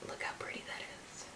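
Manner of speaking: hushed whisper; soft whisper admiring the glow; whispered admiration. Real, not acted. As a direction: breathe it out like a secret. Whispering over highlighter.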